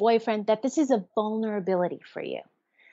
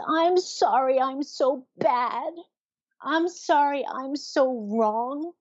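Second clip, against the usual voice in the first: higher, drawn-out self-blaming apology; shamed apology; 5 semitones higher, slower than usual. Acted. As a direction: apologize as if confessing you are bad, slowly. Voicing a shamed person's apology.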